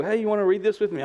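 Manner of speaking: high, quick gentle invite; sweet wife. Retold or imitated.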